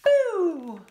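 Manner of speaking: loud, high playful boo; storyteller showing the children how to shout, loud playful boo; scary boo shout. Shown as a demonstration. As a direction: jump out with a big spooky boo. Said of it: "Let me hear you shout out".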